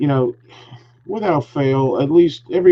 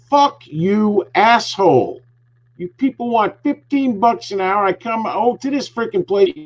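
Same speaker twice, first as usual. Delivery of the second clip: higher-pitched angry rant, imitating himself; angry yell retold; 8 semitones higher than usual. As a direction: replay the fury at the cashier, pitched up.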